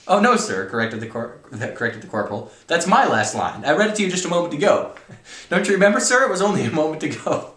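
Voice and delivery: high, loud flustered corporal; dramatic story reading. Acted. Corporal correcting the colonel, loud and pleading. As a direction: protest urgently to a superior. Reads novel aloud dramatically.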